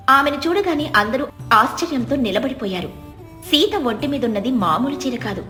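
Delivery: ordinary delivery, nothing marked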